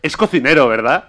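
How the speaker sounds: a fan who knows he's right; eager guess. Retold or imitated. Fan guessing the chef, retold.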